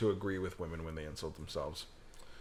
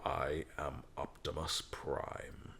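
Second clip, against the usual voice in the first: Optimus Prime voice; 6 semitones lower, 8 dB quieter, much slower than usual. Retold or imitated. Names Optimus Prime, says it.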